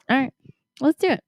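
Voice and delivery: ordinary talk, nothing marked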